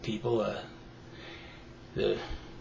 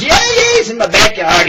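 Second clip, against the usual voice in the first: much higher, louder excited squeal; eager lady; 9 semitones higher, 7 dB louder than usual. Acted. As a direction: blurt it out breathlessly eager. Lady eagerly answers gun guy.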